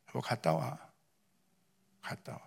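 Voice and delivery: very soft, understated imitation of a wife; quiet wifely go-ahead. Wife sending her husband off to preach, very quietly. Wife's reply retold.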